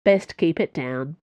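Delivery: ordinary delivery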